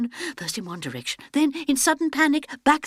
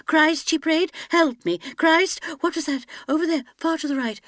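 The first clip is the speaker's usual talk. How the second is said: plain reading voice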